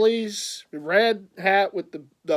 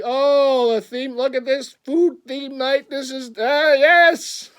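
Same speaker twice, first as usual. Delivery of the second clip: excited; 7 semitones higher, 7 dB louder, slower than usual. Retold or imitated. Retold delight at theme night.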